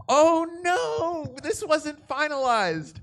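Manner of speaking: high squeaky hammed-up alarm, half laughing; mock panic. To the audience, recalling the auto-cast: mock-panicked high squeak. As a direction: squeal it like you just realised the mistake.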